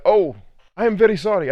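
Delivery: high, loud mimicked apology; startled apology. Scolded parent apologising to the coach, startled high-pitched mimicry. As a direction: imitate a parent jumping back to apologise.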